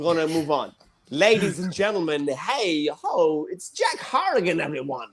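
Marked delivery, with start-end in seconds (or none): 3.5-5.1 announcer intro. Acted. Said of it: "host introduces comedian".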